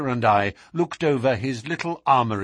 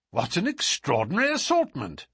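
higher, lightly astonished exclamation; 6 semitones higher than usual. A narrator's reading. Houseguest hearing of the odd loot, exclaiming. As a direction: exclaim in mild amazement. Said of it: "I exclaimed".

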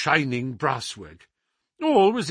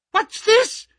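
much higher and louder, an outraged shriek; high screaming cry; 7 dB louder than usual. Acted. The scholar shrieking at the intruding doctor, high and loud. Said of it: "he cried in a high, screaming voice".

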